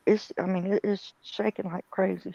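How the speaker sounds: normal talking voice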